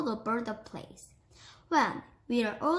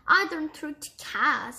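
higher, louder, oddly strained refusal; strange voice; 5 semitones higher, 6 dB louder than usual. A narrator's reading. Grandma refusing to address the cat, strange voice. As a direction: raise it into a strange voice. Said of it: "in the same strange voice".

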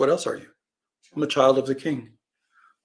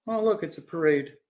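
higher, carefree mock remark; idly watch it all go by; blithe onlooker; 5 semitones higher than usual. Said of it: "do we sit blithely by going".

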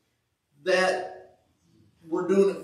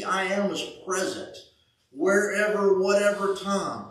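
ordinary delivery

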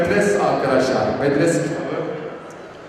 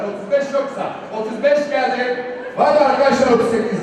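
loud, rolling auction calls; auctioneer; 4 semitones higher, 3 dB louder than usual. Real, not acted. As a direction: call the bids to the crowd. Auctioneer calling bids. (mezatçı gibi teklif çağırma)

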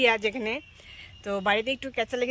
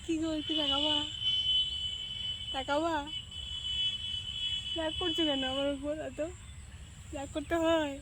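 higher, softer sweet coaxing; wife sweetly coaxing her sulking husband; 4 semitones higher, 4 dB quieter than usual. Real, not acted. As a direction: plead gently to win him round.

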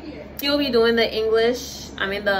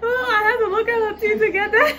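laughing; 13 semitones higher than usual. Real, not acted. Laughing hard after the word.

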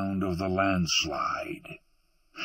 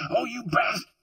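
high, gasping outburst, cut off; gasped curse. A narrator's reading. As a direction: blurt it out high as a startled boy. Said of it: "the boy gasped".

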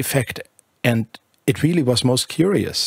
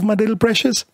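much higher, tender coo; 11 semitones higher than usual. Acted. Bertha alone with her baby, cooing much higher.

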